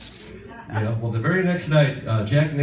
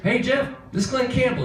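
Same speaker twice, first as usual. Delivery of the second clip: high-pitched caricature of a singer on the phone; comic high-voiced phone greeting; 14 semitones higher than usual. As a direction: exaggerate his voice, way up high. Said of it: "I do not talk like that".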